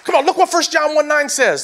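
loud, rising preacher's cry; full-force preaching. Acted. Pastor to congregation, full-force preaching. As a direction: preach it hard, pleading with the church.

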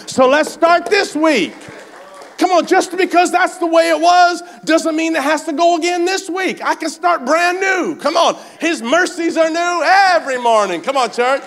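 high, loud shouted preaching, pace slowed on the big lines; rally the church with everything you've got; full-force preaching. Acted. Preacher rallying the church to start the week new, shouting.

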